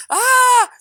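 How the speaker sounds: a loud high yell of fright; terrified scream. Retold or imitated. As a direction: shriek in terror. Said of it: "I'm like".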